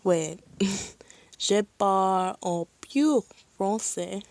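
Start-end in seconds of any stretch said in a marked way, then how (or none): 1.4-4.2 halting French with a non-native accent; amateur French accent. Shown as a demonstration. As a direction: attempt French with an imperfect accent. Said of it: "don't have the best accent".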